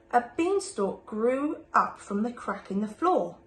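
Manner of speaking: ordinary delivery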